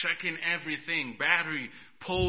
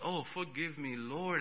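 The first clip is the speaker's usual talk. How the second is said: softer, humbled apology; quiet plea; 2 semitones lower, 8 dB quieter than usual. Retold or imitated. Preacher apologizing to the Lord, softly. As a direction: murmur it in repentance. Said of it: "I said".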